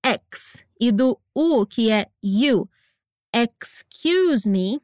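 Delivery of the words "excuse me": "Excuse me" drawn out slowly, each sound clear; teacher stretching "Excuse me" for the class; slow careful model. Shown as a demonstration. Teacher models "Excuse me" for students, very slow and careful. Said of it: "Note o som do X".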